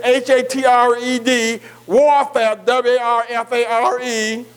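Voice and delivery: ordinary talk, nothing marked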